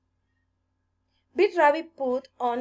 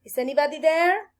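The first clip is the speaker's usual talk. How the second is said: high, quick call; 6 semitones higher, 4 dB louder, faster than usual. A narrator's reading. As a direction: call out, hoping for an answer. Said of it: "he called".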